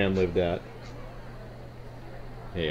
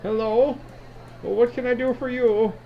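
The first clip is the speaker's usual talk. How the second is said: put-on old woman; 12 semitones higher than usual. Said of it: "looking right at Grash and she says".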